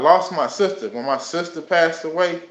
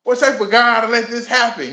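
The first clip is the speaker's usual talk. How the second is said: loud and much higher, hurling the question upward; reenacted fury, voice thrown high and loud; retold angry cry at God; 10 semitones higher, 7 dB louder than usual. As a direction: shout it as an accusation at God. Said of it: "just going off, getting mad".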